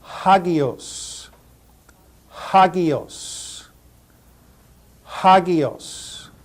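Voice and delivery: Hagios as HAH-gee-oss with a breathy h; Hagios, stress the h up front; Hagios, careful demo; rough breathing h. Shown as a demonstration. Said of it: "put an H sound in front".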